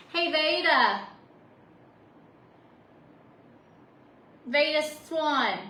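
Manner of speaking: loud call through a door. Real, not acted. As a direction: yell her name across the house. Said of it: "I had to yell through the door".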